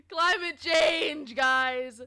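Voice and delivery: slow, high, loud drawn-out "Climate change"; milk the words like a grave warning; overdone doom punchline to chat; mock solemn.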